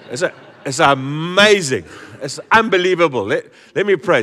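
loud, high, rising wonder; excited preaching. Real, not acted. Preacher to church, loud excited wonder. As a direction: sell the amazement to the congregation.

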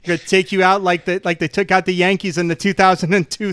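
trash-talk in broad Southie; Southie Boston accent. Acted. Doctor Strange threatening a foe like a Red Sox fan, Southie accent. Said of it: "I want the Southie Boston Doctor Strange".

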